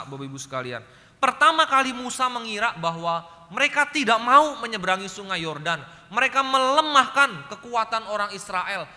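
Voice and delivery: loud sermon build on Moses' misunderstanding; full-force preaching. Acted. Preacher retelling Moses to the congregation, loud and emphatic. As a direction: proclaim it loudly to the church.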